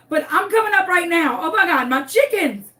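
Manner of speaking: high, loud rush of alarm; acted panic. Owner answering neighbor on the Ring app, panicking. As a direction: play up a sudden panic.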